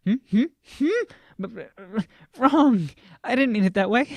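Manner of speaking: high, loud panicked denial; flustered outburst. A narrator's reading. Speaker realizes her words were misinterpreted, flustered loud denial.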